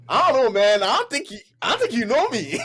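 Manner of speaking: high, loud mock protest; hammed-up offended comeback; indignant retort. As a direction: push back in exaggerated disbelief. Retold retort to critical boss.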